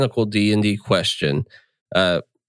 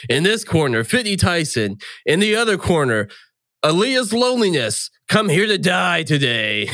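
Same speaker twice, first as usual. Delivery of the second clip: fight announcer; 8 semitones higher than usual. Acted. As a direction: announce the fighters with big showman swells. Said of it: "like, the announcer said".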